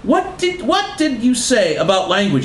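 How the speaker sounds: high-pitched indignant stammer; hammed-up outraged question; angry challenge. A narrator's reading. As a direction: start very high, come down as you challenge. Margaret turns angrily on her mother.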